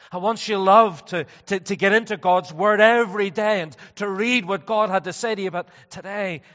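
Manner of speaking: loud, emphatic pulpit voice; loud, driving sermon delivery; forceful preaching. Real, not acted. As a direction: preach it out with conviction.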